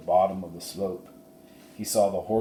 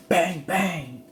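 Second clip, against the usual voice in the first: mock gunshots, higher; gunshot imitation; 9 semitones higher than usual. Narrator imitating the rustlers' gunfire, mock bangs. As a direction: pop out the shots.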